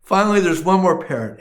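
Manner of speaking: normal talking voice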